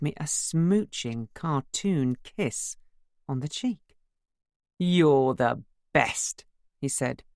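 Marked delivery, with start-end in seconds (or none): 4.8-6.4 sloppy affection. A narrator's reading. Drunken slow declaration.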